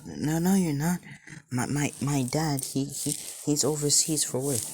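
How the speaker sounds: high, stammering protest; flustered denial. Acted. Boy tells stranger he is not his dad, flustered high stammer.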